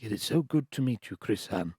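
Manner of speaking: soft, careful Japanese-accented English. Shown as a demonstration. Author demonstrating his audiobook voices, soft Japanese accent. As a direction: greet politely in a put-on Japanese accent. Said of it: "for Japanese voices, I'd be like".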